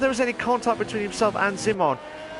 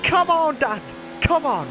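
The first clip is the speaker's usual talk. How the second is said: cheering him on; 10 semitones higher than usual. Retold or imitated. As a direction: yell it like an excited supporter. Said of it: "but he's like".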